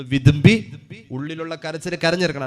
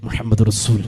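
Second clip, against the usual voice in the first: ordinary delivery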